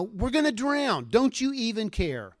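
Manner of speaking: lightly acted fearful plea; panicked disciples.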